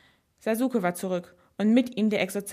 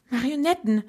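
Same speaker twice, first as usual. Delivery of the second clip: voiced, stunned half-whisper with a higher lift; mildly stunned, voiced rather than truly whispered; 4 semitones higher, 5 dB louder than usual. A narrator's reading. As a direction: say it as if the realisation just hit. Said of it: "flüsterte sie fassungslos".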